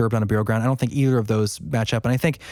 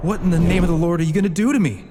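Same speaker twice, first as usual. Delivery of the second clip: panicked; 6 semitones higher, 3 dB louder, much faster than usual. Acted. Terrified Dean pleads with witch.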